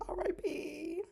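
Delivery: tiny high, soft drawn-out wail; mock dying. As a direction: give up in a high, feeble voice. Mock collapse from lack of energy.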